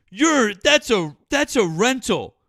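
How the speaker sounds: high, loud, drawn-out mock protest; Liam. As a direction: imitate a whiny accuser, yelling slowly. Retells Liam's protesting accusation.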